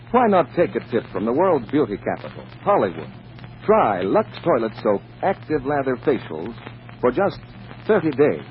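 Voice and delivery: usual speaking voice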